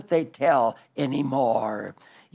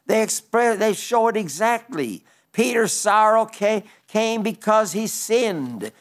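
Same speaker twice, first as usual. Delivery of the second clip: higher, driving pulpit cadence; raised preaching contrast; 4 semitones higher than usual. Acted. Preacher contrasting Peter's and Judas' sorrow, raised and rhythmic. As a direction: hammer each parallel line home.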